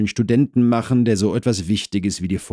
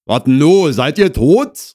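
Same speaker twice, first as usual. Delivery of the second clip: very loud, raised shout, slowing at the end; called out; 8 semitones higher, 10 dB louder than usual. Acted. Husband breaks the silence at the door, shouting. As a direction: holler impatiently from indoors. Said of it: "rief der Mann".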